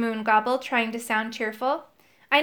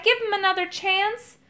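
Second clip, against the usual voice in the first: pleading; 9 semitones higher than usual. A narrator's reading. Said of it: "I cried".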